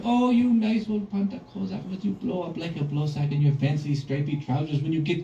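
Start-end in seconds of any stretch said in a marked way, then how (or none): 0.0-1.4 put-on sweet child voice. Children flatter the monster.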